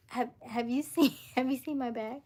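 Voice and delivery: asking through giggles; stifle a laugh while asking; giggling question. Retold or imitated. To the cat lady, asking about her bag, giggling.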